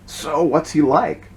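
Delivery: slow, drawn-out crowing question; an eager chicken presses Cindy, slowly and drawn-out. A narrator's reading. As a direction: stretch the question out like a crowing hen. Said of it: "crowed the chicken".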